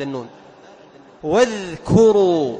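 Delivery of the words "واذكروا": slow, loud recitation of واذكروا with the ذ sounded out; sustained Quranic recitation of واذكروا; clear dhal in wadhkuru. Shown as a demonstration. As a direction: stretch واذكروا and voice the ذ before the ك. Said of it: "الذال عند الكاف".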